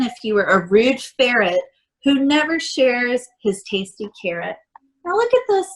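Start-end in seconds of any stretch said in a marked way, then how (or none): 5.0-5.7 much higher, bright storytime excitement; high-pitched delighted look-at-this; excited pointing. Real, not acted. Showing picture to children.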